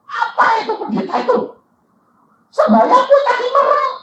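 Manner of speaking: high, loud indignant outburst; mock-outraged husband, pitched high. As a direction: snap back as the outraged husband. Husband's indignant retort quoted.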